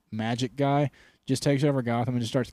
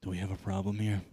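low Batman threat; 6 semitones lower, 9 dB quieter, faster than usual. Retold or imitated. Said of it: "he turns around and he's like".

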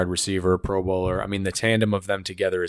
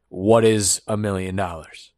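usual speaking voice

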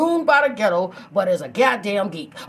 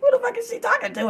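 high, baffled snap-back. Retold or imitated. Snapping back at her, confused and high. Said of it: "I be snapping back wondering".

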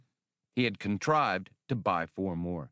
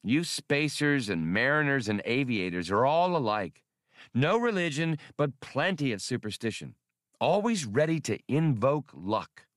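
higher, scornful lecture; sniffy disdain; 6 semitones higher than usual. Acted. Hasty scolds the spacers for their superstition, disdainful. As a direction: look down your nose at spacers. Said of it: "Hasty sniffed".

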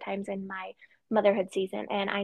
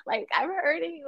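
hammed-up higher-pitched whine; playfully overdramatic self-pity, pitched up; mock meltdown; 7 semitones higher than usual. Woman imitating herself when Mother Nature's sneaking up, mock meltdown.

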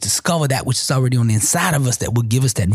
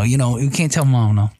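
ordinary talk, nothing marked

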